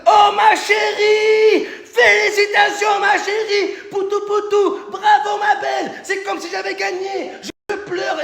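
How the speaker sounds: high, shrieking mock-delighted runner-up; fake congratulations. Losing Miss France finalist fawning over the winner, hammed-up fake joy. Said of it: "cette putain de faux cul".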